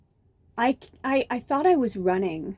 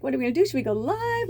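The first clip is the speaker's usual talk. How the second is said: high, eager questions, re-enacted; excited little fairy; 5 semitones higher, faster than usual. Voicing her little fairy asking for plans, excitedly. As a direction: climb higher with each hopeful question. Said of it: "my little fairy was just so excited".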